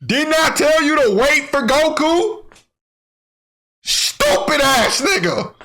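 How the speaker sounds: high, slow mocking heckle; taunting from the sideline. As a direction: jeer at him like a gloating spectator. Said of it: "I would've been up there on the sideline like".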